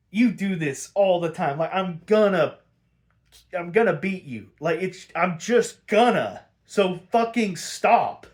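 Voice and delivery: hammed-up exasperated plea; mock fed-up. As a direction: act tired of his nonsense, building. Retold Batman fed up with Riddler.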